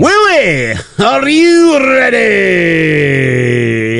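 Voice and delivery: high, shouted Gladiators announcer call; booming defiant gladiator. Pretend. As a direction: bellow it like a TV Gladiators host. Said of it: "in the Gladiator's voice".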